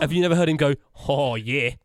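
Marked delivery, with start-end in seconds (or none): 1.1-1.7 mock Australian accent; imitating a friend's bad Australian accent; James. Mimicking a friend who claims accents, poor Australian accent. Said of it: "I do a really good Australian accent".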